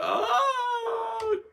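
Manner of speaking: very high wailing sob; hammed-up high sob; mock sob. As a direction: wail like she's bawling. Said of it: "I remember bawling my eyes out".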